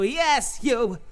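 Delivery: usual speaking voice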